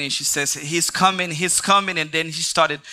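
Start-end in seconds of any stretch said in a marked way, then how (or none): 0.5-2.0 higher-pitched imitation of a frightened sister; mock a panicked cry, without full screaming; retold panicked scream. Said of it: "she started screaming".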